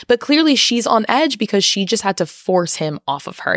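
usual speaking voice

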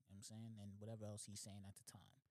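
usual speaking voice

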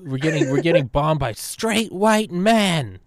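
high, loud, slow mocking wail; mock panic.

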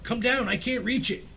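put-on high, strained old woman's call; strained cry. A narrator's reading. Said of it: "strained and panting".

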